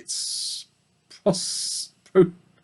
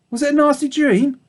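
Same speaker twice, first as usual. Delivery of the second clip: Fly asking Babe about his dream; gentle mother dog; 12 semitones higher, 4 dB louder than usual. Acted. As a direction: ask kindly like a mother. Fly's voice.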